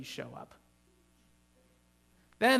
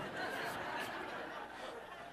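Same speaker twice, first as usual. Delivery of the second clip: breathy laugh; 11 dB quieter than usual. Real, not acted. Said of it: "this is why no one sits in the front row".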